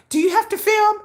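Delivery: high, whiny mock complaint; high nagging imitation; annoyed friend. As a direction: mimic her nagging about the camera. Mocking Jennifer's complaint.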